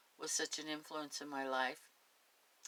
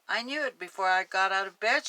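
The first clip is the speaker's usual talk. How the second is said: put-on louder eager old cousin; excited; 5 semitones higher, 12 dB louder than usual. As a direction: burst out with excitement. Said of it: "purposeful excitement".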